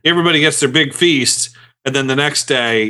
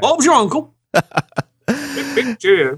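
high, hammed-up British catchphrases; mock British accent; 11 semitones higher than usual. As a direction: play a cartoon Brit. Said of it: "read it in a British accent".

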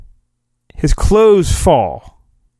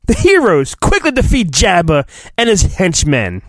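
ordinary talk, nothing marked

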